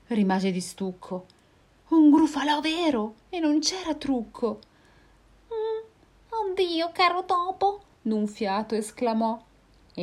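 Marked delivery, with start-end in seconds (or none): 1.9-7.8 high, frightened cries; panicked fox. Acted. Fox seeing the Gruffalo, high and frightened. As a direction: gasp in fear, high.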